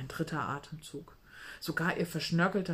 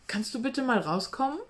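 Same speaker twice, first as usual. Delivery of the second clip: calling out; 6 semitones higher, 4 dB louder than usual. Acted. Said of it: "rief sie".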